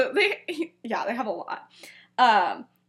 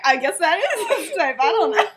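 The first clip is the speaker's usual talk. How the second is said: high, louder, giggly delivery; laughing retelling; 5 semitones higher, 4 dB louder than usual. Real, not acted. Retelling the boys' teasing to a friend, laughing. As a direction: laugh through the quoted reply.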